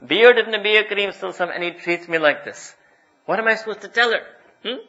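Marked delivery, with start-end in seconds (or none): 0.0-2.7 loud, pleading complaint of a wronged wife; louder, voicing her grievance; quoting the wife. Preacher voices a wife complaining about husband, loud and hurt. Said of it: "this woman that she tells to me".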